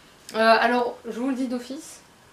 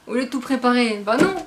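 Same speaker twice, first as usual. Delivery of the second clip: mock exasperation; 2 semitones higher, 9 dB louder than usual. Mock scolding herself for tidying.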